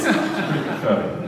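said through a laugh. Real, not acted. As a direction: laugh while saying it. Repeats with a laugh.

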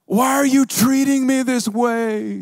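high, loud, wailing plea; imitating a sobbing yell. Young woman pleading with Alex, high sobbing yell. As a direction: wail it like a heartbroken young woman. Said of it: "Sobbing, crying, yelling".

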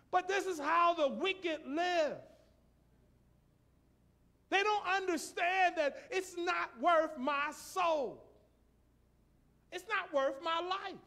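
loud, slow, rising sermon cry; preaching at full force. Real, not acted. Preacher crying out to the church, loud and slow. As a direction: hammer it home to the congregation.

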